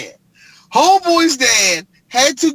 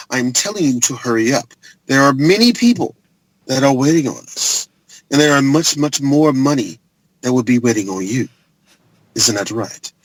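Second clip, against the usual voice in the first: Dean Norris impression; 6 semitones lower than usual. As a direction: lean in like a smug corporate villain. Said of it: "when he first talked".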